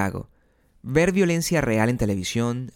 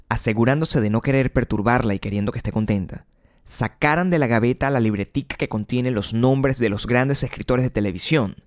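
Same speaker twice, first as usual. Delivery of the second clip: ordinary delivery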